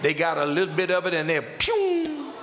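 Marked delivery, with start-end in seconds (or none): 1.4-2.3 high, drawn-out zooming "pew"; quick-departure whoosh, voiced high; imitated "pew". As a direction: shoot off a long high pew.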